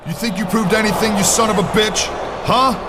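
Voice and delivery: high, sneering, rising fury; angry taunt. Acted. Randy standing over the beaten champion, rising high angry taunt. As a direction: jab each question at him harder.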